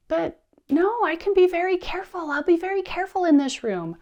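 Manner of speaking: put-on high, insistent kid voice; pleading child. Child bargaining with parent over snack, high pleading whine. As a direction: mimic a little boy begging and promising.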